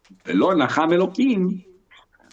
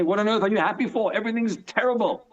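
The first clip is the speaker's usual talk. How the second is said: higher-pitched disbelief; incredulous challenge; 6 semitones higher than usual. Acted. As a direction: question their happiness in mild disbelief.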